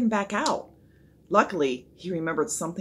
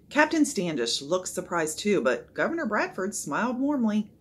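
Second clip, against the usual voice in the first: plain reading voice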